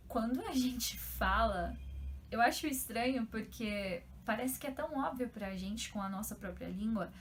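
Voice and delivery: ordinary delivery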